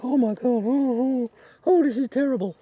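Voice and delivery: high, loud, hammed-up wail; mock wailing woman. Retelling the film, [name] wails in a hammed-up high voice. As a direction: play a hysterical weeping woman for laughs. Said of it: "typical booing woman".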